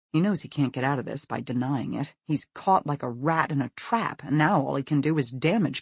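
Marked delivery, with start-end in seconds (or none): none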